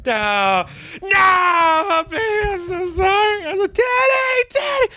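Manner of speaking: hammed-up anguished wail; Tig's wailing grief. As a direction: wail like a broken father. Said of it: "Kim Coates did Tig, you know, go".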